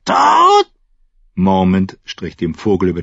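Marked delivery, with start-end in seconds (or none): 0.1-0.7 very high, loud bird shriek; louder, shriller raven. Acted. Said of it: "Seine Stimme wurde lauter und schriller".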